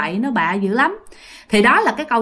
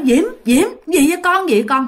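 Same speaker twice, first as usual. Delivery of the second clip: retold urgent calling; 6 semitones higher than usual. Grandfather running to check on Diễm, acted urgent calling. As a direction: call out in acted alarm. Grandfather rushes over, asking anxiously.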